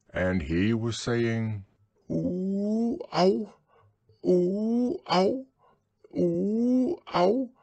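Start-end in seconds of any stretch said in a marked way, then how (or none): 2.1-7.5 high squeaky ooh ow; little animal whimpering ooh ow; squeaky Piglet cries. A narrator's reading. Character cries ow repeatedly.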